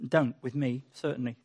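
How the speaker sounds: ordinary delivery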